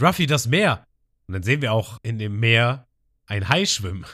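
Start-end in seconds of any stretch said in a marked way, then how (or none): 0.0-0.8 higher, louder warning cry; Nami screaming. Retold or imitated. Nami warns Ruffy about the sea, shouting. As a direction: shout the warning across the deck. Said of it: "Und Nami schreit".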